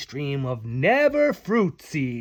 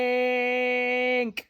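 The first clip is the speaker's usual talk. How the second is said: excited call; 8 semitones higher than usual. Acted. Beck calls out to Tink.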